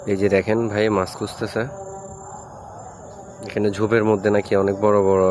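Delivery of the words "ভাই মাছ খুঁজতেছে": ordinary talk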